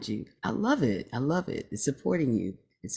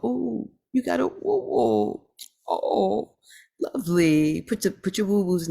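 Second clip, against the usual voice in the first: delighted squeal; 8 semitones higher than usual. Real, not acted. Host spots a viewer's pet.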